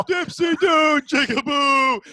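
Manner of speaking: very loud, high announcer yell; Dick Vitale. Retold or imitated. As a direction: holler a catchphrase, stretching the last word slowly. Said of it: "Dave would just yell like that".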